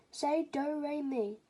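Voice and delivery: slow, sung-out do re mi; drawn out like a singing lesson; slow teaching voice. Acted. Grandfather testing singing.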